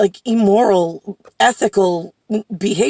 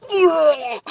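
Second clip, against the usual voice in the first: high, drawn-out "ugh"; recoiling groan of revulsion; disgusted groan; 7 semitones higher than usual. Real, not acted. Disgusted by grandmother's egg recipe.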